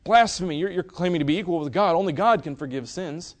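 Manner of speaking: high, loud indignant accusation; outraged Pharisees. Acted. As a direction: cry out in mock religious outrage. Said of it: "what did the Pharisees say?".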